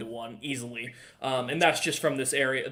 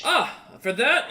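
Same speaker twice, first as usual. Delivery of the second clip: higher, louder, slow delighted thanks; pleased merchant; 9 semitones higher, 6 dB louder, slower than usual. Acted. Merchant thanking the buyer for the tip, slow and pleased. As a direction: savour the tip. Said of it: "He takes it and goes".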